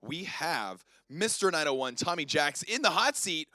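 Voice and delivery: loud, upbeat game show welcome; show host intro. Acted. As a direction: announce the guest like a showman.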